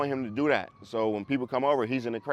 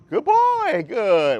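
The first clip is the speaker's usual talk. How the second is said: bright high-pitched praise, a bit louder; 10 semitones higher, 5 dB louder, much slower than usual. Retold or imitated. Trainer praising the dog as it follows, high and sing-song. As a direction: cheer the dog on in a much higher voice.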